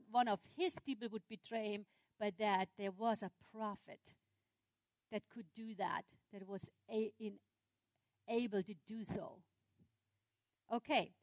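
usual speaking voice

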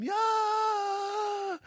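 high, drawn-out cry of triumph; joyful yeah. Retold or imitated. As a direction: whoop it out like winning. Jacob's joyful text, celebrating.

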